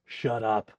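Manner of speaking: low gruff grumble; gruff satyr telling off a neighbour; gruff satyr prisoner. Acted. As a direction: growl it like an irritable cellmate. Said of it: "very gruff sounding satyr prisoner".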